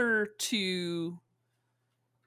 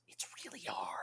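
whisper; 15 dB quieter than usual. Real, not acted. Confessing the job is hard.

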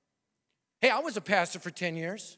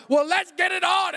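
loud, much higher combative shout; angry challenge; 11 semitones higher, 8 dB louder than usual. Retold or imitated. Pastor challenging his wife in front of the group, loud shout. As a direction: throw down the gauntlet.

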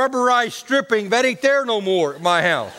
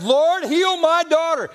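pleading call in a raised pitch; acted crying out; 10 semitones higher than usual. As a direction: cry it out like a desperate mother, pitched way up. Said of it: "Here's what Joy cries out".

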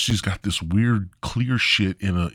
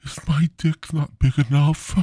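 higher, whiny insecure voice; insecure whine; 6 semitones higher than usual. Retold or imitated. Comedian mocking his own insecure side after sex, higher whine. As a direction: plead like a needy insecure lover. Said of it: "I get all like".

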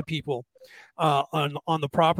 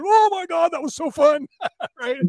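high, loud gushing crowd voice; pitched-up mock excitement; imitated thrill; 11 semitones higher, 4 dB louder than usual. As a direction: squeal like a thrilled visitor running out. Imitating thrilled haunted house visitors.

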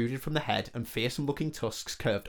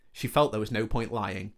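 ordinary delivery

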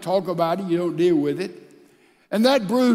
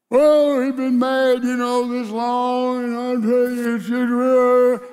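higher, weary shrug about a long marriage; bored complaint; 4 semitones higher than usual. Retold or imitated. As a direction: sigh it out like the spark is gone. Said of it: "I hear all of that".

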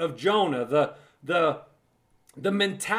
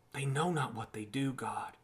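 soft prayer; 7 semitones lower, 12 dB quieter than usual. Acted. Quoted prayer of mercy.

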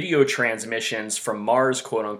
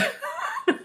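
laughing; 4 dB quieter than usual. Real, not acted. Laughs after spoiler joke.

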